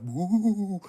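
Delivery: high, loud spooky ghost woo; playful spooky hoot. Retold or imitated. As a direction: mimic a cartoon ghost. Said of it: "they be like".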